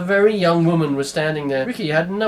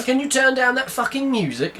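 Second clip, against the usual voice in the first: high, annoyed shout; irritated yell from the hallway; 9 semitones higher than usual. Acted. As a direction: shout over the music. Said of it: "shouted some guy".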